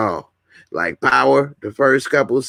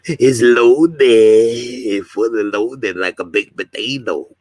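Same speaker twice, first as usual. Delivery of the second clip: mock-excited loud goofy voice, then slower; 4 dB louder, slower than usual. Streamer hamming up that comments finally loaded, goofy voice. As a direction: ham up the announcement like a jingle. Playful sing-song about posts loading.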